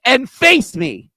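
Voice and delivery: loud, high angry outburst; yelling. Real, not acted. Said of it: "if I just stop yelling".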